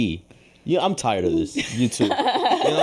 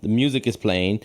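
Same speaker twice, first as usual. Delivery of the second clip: ordinary talk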